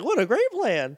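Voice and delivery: high, pleased little robot voice; proud schoolmistress praising a pupil; prim old robot teacher. Retold or imitated. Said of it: "Old little robot Professor McGonagall".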